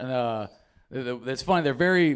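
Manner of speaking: normal talking voice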